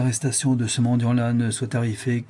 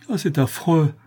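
higher-pitched, indignant outcry; 5 semitones higher than usual. A narrator's reading. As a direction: protest with a touch of outrage. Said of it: "dit Rigolette avec indignation". (exclamation indignée)

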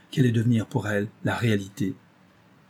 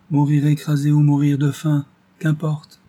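bitter resignation with a sad smile, higher and louder; heartbreaking smile; 5 semitones higher, 6 dB louder than usual. A narrator's reading. Blind girl answering the narrator, resigned with a sad smile. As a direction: shrug off death with a pained smile. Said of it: "avec un sourire navrant".

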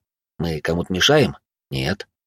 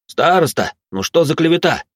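higher, louder outraged protest; indignant protest; 8 semitones higher, 4 dB louder than usual. Acted. A student protests loudly to the head girl, mock outraged. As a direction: cry foul at the slander. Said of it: "немедленно возмутился один из студентов".

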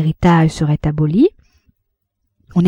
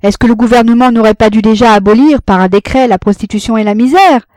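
loud, high tragic declamation; grand tragic stage delivery; 4 semitones higher, 6 dB louder than usual. A narrator's reading. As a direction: declaim it like a tragedian. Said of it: "d'un ton tragique". (ton tragique et déclamatoire)

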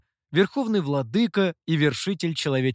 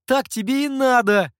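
gloating laugh; 9 semitones higher, 6 dB louder than usual. A narrator's reading. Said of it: "отчего засмеялся".

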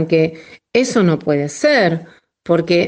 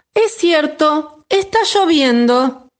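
little chick voice; 9 semitones higher than usual. A narrator's reading. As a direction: answer gently as a tiny chick. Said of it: "dijo el pollito".

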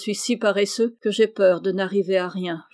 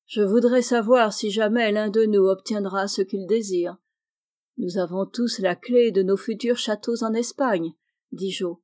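ordinary delivery, nothing marked

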